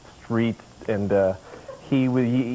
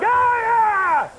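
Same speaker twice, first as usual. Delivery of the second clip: high, loud yelling scream; demo yell; 5 dB louder than usual. Shown as a demonstration. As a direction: yell like a madman to scare them off. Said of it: "Just start yelling".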